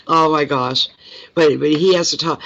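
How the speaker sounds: normal talking voice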